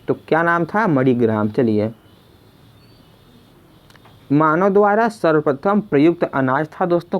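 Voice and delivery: usual speaking voice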